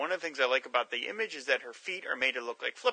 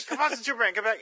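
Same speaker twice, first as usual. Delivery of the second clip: higher-pitched call across the room; calling the cat; 10 semitones higher, 3 dB louder, faster than usual. Pretend. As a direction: call the cat back home. Calling cat with long name.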